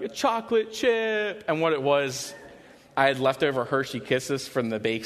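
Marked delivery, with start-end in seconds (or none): 0.0-1.4 put-on high, slow little-girl voice; small child confessing. Daughter admitting what she ate, high slow toddler voice. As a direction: say it like a sheepish toddler. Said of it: "And she was like".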